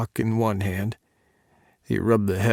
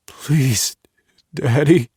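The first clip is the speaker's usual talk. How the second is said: louder, pleading, falling at the end; a breathless lover begging Daddy for more; 3 semitones higher, 5 dB louder, slower than usual. Acted. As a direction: plead with him, desperate for release. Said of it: "I begged him".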